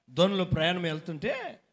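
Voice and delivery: ordinary talk, nothing marked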